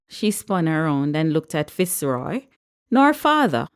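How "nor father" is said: high-pitched, pointed disgust. A narrator's reading. Patricia turning on Fitzroy, disgusted. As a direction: spit it at him with disgust, pitched up. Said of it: "disgust evident in her voice".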